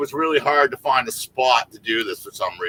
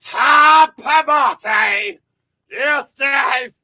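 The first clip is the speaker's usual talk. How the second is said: mock Scottish accent; 10 semitones higher, much slower than usual. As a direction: bellow the song in a bad Scottish brogue. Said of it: "Can you do it in a Scottish accent?".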